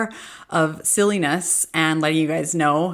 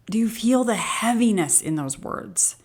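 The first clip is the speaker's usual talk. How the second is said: ordinary talk, nothing marked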